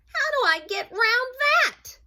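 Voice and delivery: high, loud, dismayed mouse; exasperated complaint. Acted. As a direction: exclaim as if completely stuck. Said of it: "sighed Mouse".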